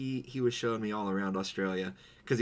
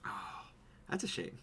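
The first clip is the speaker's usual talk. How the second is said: mock sympathy whisper; 10 dB quieter, faster than usual. Real, not acted. Sarcastic aside.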